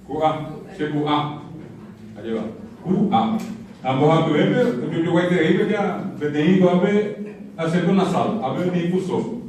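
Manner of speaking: ordinary talk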